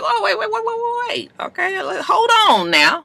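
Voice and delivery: high, soft mock-flustered stalling; flustered protest. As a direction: stammer out a hasty hold-off. Man fending off an advance.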